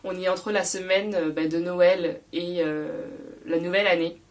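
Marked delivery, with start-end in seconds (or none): none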